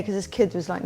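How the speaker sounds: ordinary delivery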